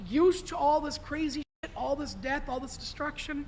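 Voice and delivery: usual speaking voice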